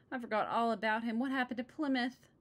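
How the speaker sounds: worried. Acted. Sister alarmed about her turkey.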